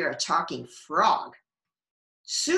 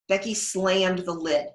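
usual speaking voice

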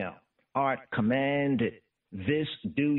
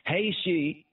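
ordinary talk, nothing marked

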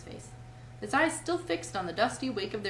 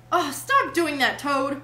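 loud, high, drawn-out plea; despairing cry; 5 semitones higher, 9 dB louder, slower than usual. A narrator's reading. Mole begs Toad to stop his raving, despairing cry. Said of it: "cried the mole despairingly".

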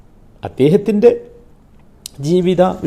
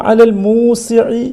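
slow, louder, higher chant of the verse; recited verse; 6 semitones higher, 4 dB louder, much slower than usual. Shown as a demonstration. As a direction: recite it slowly so they hear each sound. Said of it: "ഞാൻ pronounce ചെയ്തത്". (ഉറക്കെ പതുക്കെ പാരായണം)

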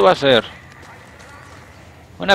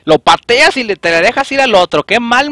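higher, louder frustrated scolding; exasperated complaint; 6 semitones higher, 4 dB louder than usual. Real, not acted. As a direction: berate the teammate for the bad move. Scolding teammate's bad maneuver. (reproche exasperado)

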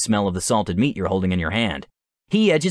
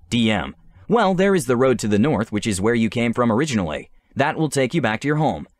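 ordinary delivery

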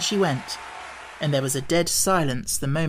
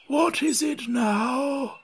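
hoarse frog whisper; 9 semitones higher, much slower than usual. A narrator's reading. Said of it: "the frog said in a deep, hoarse whisper".

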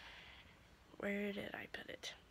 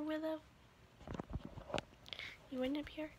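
normal talking voice

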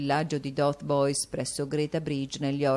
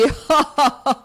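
a high, loud wicked cackle; gleeful cackle; 12 semitones higher, 10 dB louder than usual. A narrator's reading. Squeers cackling over his swindle, high cackle. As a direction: cackle at your own scheme.

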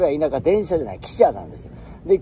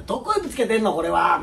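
mother scolding; 7 semitones higher, faster than usual. Retold or imitated. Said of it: "おかんに怒られて".

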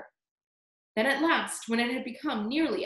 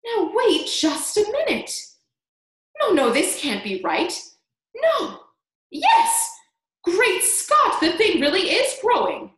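loud, high-pitched astonished cries; excited shout; 9 semitones higher, 7 dB louder than usual. A narrator's reading. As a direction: shout it in mounting amazement. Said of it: "Aunt Spiker shouted".

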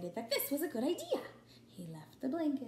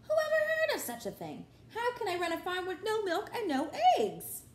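indignant; 8 semitones higher, 5 dB louder than usual. Acted. Farmer's outraged exclamation.

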